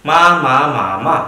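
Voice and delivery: teacher models each tone of ma in turn; four tones on ma. Shown as a demonstration. Teacher showing the class the four tones, clearly modelled.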